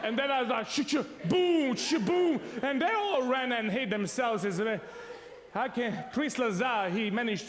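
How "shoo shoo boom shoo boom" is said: shotgun sound effects. Retold or imitated. Imitating shooting everyone.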